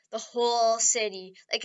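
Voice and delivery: ordinary talk, nothing marked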